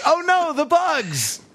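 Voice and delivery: high, slow mock scream; hammed-up terror at the bugs; mock panic.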